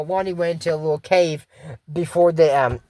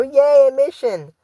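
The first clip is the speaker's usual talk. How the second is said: mock cheer; 7 semitones higher, 6 dB louder, slower than usual. Voicing excited character.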